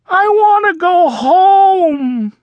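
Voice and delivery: high, loud, slow wail; whiny wail. Acted. Froggy begging his parents at camp, slow high whiny wail. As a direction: whine it like a homesick kid. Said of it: "cried Froggy".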